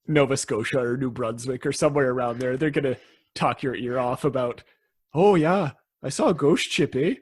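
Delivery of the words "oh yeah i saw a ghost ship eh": put-on Canadian accent. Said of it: "I was just doing a general Canadian accent".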